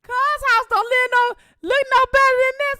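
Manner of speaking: loud high mock mama rant; mama's loud mocking reply; mama scolding. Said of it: "then come my mama".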